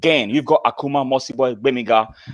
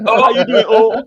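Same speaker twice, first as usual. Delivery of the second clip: Nigerian pidgin greeting, higher and laughing; mock pidgin greeting; 7 semitones higher, 6 dB louder than usual. Host mocking Akuma's greeting, hammed-up Nigerian pidgin. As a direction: tease them in Nigerian pidgin, pitched up. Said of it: "Can you say that again?".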